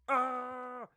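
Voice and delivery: high, loud mimicked yell; imitated top-of-lungs yell. Bandmate imitating an audience member at a show, top-of-lungs yell. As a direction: belt out an "ah" like the crowd member. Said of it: "yells at the top of his lung".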